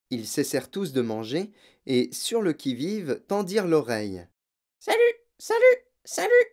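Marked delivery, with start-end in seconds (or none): 4.8-6.5 very high, squeaky parrot voice; squawking parrot. A narrator's reading. Parrot suddenly greeting Matilda and Fred, squawking. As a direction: cry out like a parrot. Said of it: "reprit la voix".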